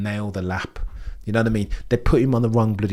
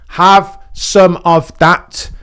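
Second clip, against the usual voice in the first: slow, drawn-out gloat, much louder; gloating cry; 7 semitones higher, 12 dB louder, much slower than usual. Real, not acted. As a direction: rub it in, stretching every word. Gloating at rival fans during qualifying.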